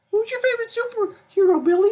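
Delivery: put-on sing-song high voice to a kid; high mock adult voice. Mimicking an adult asking a kid.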